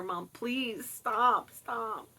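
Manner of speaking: drawn-out retold plea; begging. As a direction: plead with mom to stop, stretching the words. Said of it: "I've been begging her".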